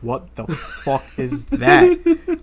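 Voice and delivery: slow, high laughing disbelief; retold bafflement. Reacting to girlfriend's new alarm, retold slow disbelief. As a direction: stretch it out, incredulous, through laughter.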